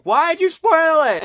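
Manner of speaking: high, slow whiny viewer complaint; mock commenter whine. Reviewer mimicking commenters who accuse him of spoilers, high slow whine. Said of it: "I got lots of comments of like".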